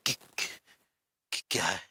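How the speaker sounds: low, nervous stutter; scared, halting reply; frightened stammer. Acted. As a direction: stutter fearfully on the first syllable. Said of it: "हमें भी तो डर लग रहा है".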